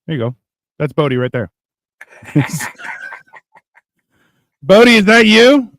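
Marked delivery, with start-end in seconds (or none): 4.6-5.7 loud mocking call. Real, not acted. Laughing at picture.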